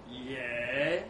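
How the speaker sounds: low, drawn-out questioning yeah; slow. A narrator's reading. Trev answering his mate warily, slow. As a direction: stretch it out slowly and low. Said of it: "he says slowly".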